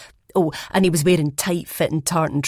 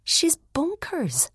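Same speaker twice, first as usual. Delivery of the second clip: put-on high, slow girlish voice; drawn-out high young woman; high character voice; 12 semitones higher, much slower than usual. A narrator's reading. Young woman calling her friend bonkers, high and slow.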